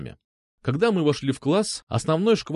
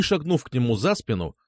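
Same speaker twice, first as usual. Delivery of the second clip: ordinary delivery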